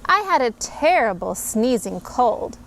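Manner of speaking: ordinary delivery, nothing marked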